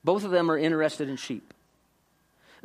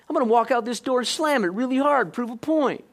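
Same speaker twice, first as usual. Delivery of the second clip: high, loud and fast; riled-up vow; 7 semitones higher, 4 dB louder than usual. Retold or imitated. Husband storming off to prove a point, loud and fast. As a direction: rattle it off, fired up and defiant.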